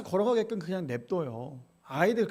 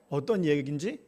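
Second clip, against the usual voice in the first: ordinary talk, nothing marked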